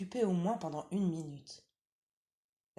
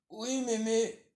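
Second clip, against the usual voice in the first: put-on wolf putting on a slightly higher little-girl voice; wolf pretending to be the granddaughter; wolf faking girl; 6 semitones higher, 5 dB louder, slower than usual. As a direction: coax like a sly wolf playing innocent.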